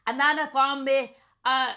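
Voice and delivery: put-on loud, angry-sounding Scottish accent. Player improvising about haggis hunting, angry Scottish accent. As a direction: play a riled-up Scot. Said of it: "Okay, we're going Scottish".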